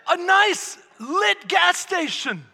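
loud, high-pitched frantic narration; frantic storytelling. Acted. Preacher tells of woman fleeing trucker, frantic storytelling. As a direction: push the chase tale toward a scream. Chase story at full force.

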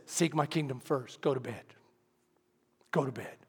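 low, soft, patient orders; quiet, low inner voice; hushed divine voice. Retold or imitated. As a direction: instruct softly and calmly. God's quiet repeated instruction.

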